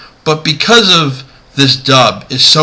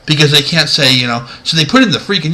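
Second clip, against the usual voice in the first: normal talking voice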